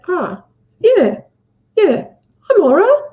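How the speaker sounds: put-on brave little boy. Retelling the boy's answer to his mum, in a high bright child voice. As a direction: chirp it up high like a small boy putting on a brave face.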